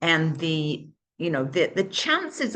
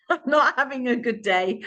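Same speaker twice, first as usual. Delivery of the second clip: laughing through the line, pitched higher; 7 semitones higher than usual. Retold or imitated. Retelling what she told Daniel, laughing. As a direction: laugh it out at herself.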